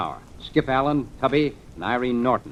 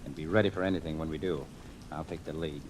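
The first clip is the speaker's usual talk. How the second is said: low, hushed and much softer; 8 semitones lower, 10 dB quieter than usual. Acted. Detective briefing his men as they creep up, hushed. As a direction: murmur it so the houseboat can't hear. About to board the houseboat.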